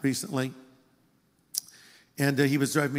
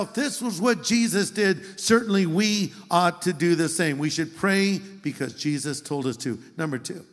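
ordinary talk

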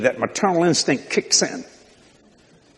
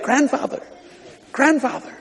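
put-on higher, childlike grandfather; grandbaby saying grandfather; 9 semitones higher than usual. Preacher imitates the grandbaby saying grandfather, higher. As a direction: say it like a toddler trying the word. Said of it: "she heard it".

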